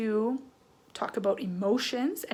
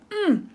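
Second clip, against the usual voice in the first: angry hum; 9 semitones higher than usual. Shown as a demonstration. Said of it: "His, like".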